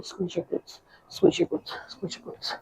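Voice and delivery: breathy whispered coaching cue; whispering. Shown as a demonstration. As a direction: whisper it like a secret. Coaching cue repeated during rep.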